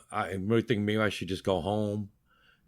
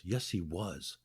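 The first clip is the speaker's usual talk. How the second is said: much softer, low insistence; low murmur; 4 semitones lower, 11 dB quieter, much slower than usual. Retold or imitated. Friend insisting under his breath, much softer murmur. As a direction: murmur it so he can't hear. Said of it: "talking in low tones".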